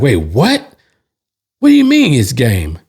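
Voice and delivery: imitating a startled opponent, pitch leaping up; mock incredulous questioning; baffled disbelief. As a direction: act out his confused double take.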